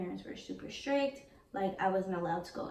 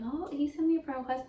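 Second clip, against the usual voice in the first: higher, quick burst of retold excitement; excited quote, rushed and higher; 6 semitones higher, faster than usual. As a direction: act out a teenage thrill, pitched up and rapid. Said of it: "And I'm excited".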